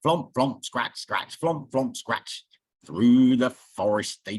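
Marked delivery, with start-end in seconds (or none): none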